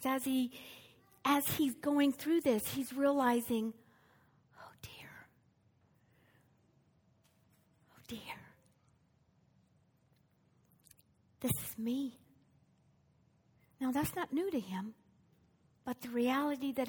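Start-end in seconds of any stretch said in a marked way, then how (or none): 4.6-12.2 whispered, softer, dawning dread; dismayed whisper. Acted. Preacher voicing Jesus' troubled thought, whispered. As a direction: breathe it out as a private realization. Jesus realizing his coming death.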